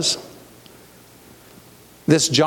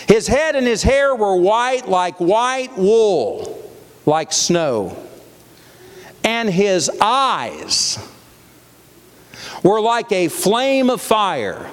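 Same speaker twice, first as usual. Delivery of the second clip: raised, very slow Bible reading; scripture reading; 4 semitones higher, much slower than usual. A narrator's reading. Preacher describing the glorified Christ from scripture, proclaiming. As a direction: stretch each image out, pausing between phrases. Reads scripture aloud to congregation.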